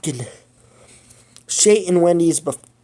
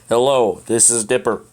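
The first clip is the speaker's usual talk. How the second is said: manly voice; 4 semitones lower than usual. Shown as a demonstration. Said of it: "such a manly voice".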